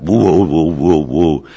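lightly imitated dog's woo-woo howl; mild mock dog howl. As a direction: sing out woo like a vocalizing dog. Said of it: "trained their dog to vocalize".

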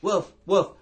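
loud, high mock woof; barking dog. Owner's dog barking at the trainer, mock woofs. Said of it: "the dog is going".

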